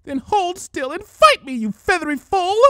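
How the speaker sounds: furious villain shouting at Dash; exasperated shout. A narrator's reading. Changeling shouting at Rainbow Dash, exasperated. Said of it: "shouted the changeling, exasperated".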